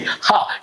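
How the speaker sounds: ha pushed out from the lungs; one strong breath on ha; breathy h demo. Shown as a demonstration. Teacher counting in the class for the h. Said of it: "One, two, three".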